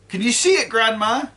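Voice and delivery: put-on boy shouting up to his grandma; excited yell. A narrator's reading. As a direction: call it out, thrilled. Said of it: "George shouted".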